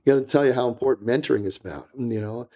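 ordinary talk

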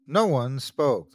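ordinary delivery

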